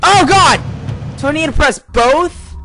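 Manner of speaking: loud, high frantic yelps; panicked. Real, not acted. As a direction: cry out as they close in. Fleeing monsters again.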